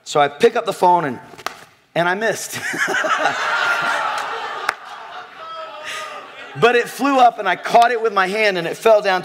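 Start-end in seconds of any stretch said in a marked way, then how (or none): 2.6-6.5 wheezing high-pitched laugh; high breathy laugh. Real, not acted. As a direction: break into a silent squeal of laughter.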